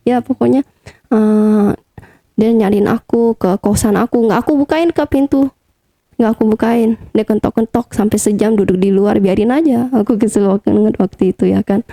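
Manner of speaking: normal talking voice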